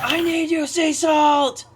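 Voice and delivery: high theatrical plea; dramatic cry. Pretend. Dramatic call.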